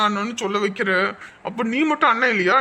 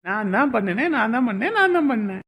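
playful bickering; 7 semitones higher, 4 dB louder than usual. Acted. Couple playfully arguing over blame.

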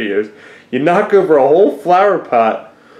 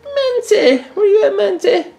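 very high sing-out to a cat; pet call. Real, not acted. Owner searching for the hiding cat, high pet call. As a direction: call the cat in a squeaky falsetto.

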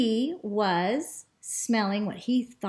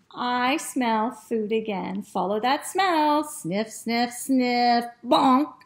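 put-on lively, expressive children's storytelling; animated story reading; 4 semitones higher, 5 dB louder, slower than usual. A narrator's reading. Teacher reads the dog's adventure to children, animated storytelling. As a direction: read it with big swoops and stretched key words.

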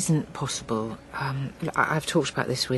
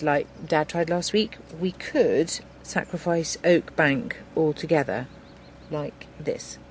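ordinary talk, nothing marked

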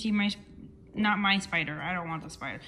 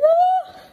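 high, loud re-enacted scream; short shriek, acting out the fright; retold scream; 13 dB louder than usual. Vlogger re-enacting her scream at the spider, shriek. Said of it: "'cause I screamed".

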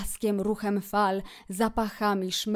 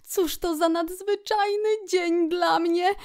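high-pitched, thrilled outburst; delighted exclamation; 9 semitones higher than usual. A narrator's reading. Kret overjoyed at the river outing, delighted exclamation. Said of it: "wykrzyknął".

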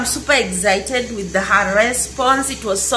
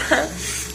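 soft giggle; 4 semitones higher, 7 dB quieter than usual. Real, not acted. Laughing about her sweet auntie.

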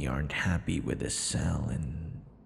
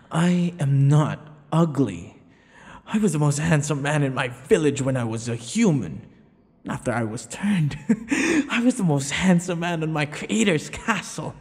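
louder, higher and fast, puffed up with pride; vain boasting; 8 semitones higher, 5 dB louder than usual. Acted. Vampire protesting to his captive that he is handsome, loud and vain. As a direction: brag as if your honour is at stake.

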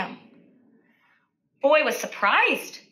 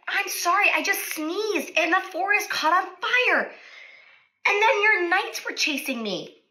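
apologetic dragon; 7 semitones higher than usual. Acted. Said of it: "the dragon said".

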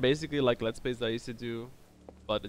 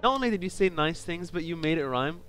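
excited; 5 semitones higher, 5 dB louder than usual. Real, not acted. Thanking subscriber, excited.

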